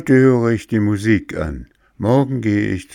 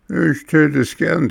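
higher, louder mocking echo; 10 semitones higher, 4 dB louder than usual. Boy mocks Bengele by echoing his excuse, taunting. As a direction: throw his own words back at him. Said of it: "spottete ihn dieser aus".

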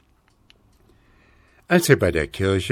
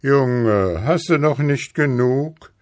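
lightly voiced old Moon, higher questioning lift; a hint of an old Moon reproving a child; guter alter Mond; 6 semitones higher, 5 dB louder than usual. A narrator's reading. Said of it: "sagte der gute alte Mond". (leicht gespielter alter Mond, mahnend fragend)

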